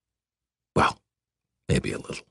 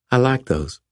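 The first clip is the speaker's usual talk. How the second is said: put-on higher, playful young woman; 8 semitones higher than usual. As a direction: tease him like a Southern belle.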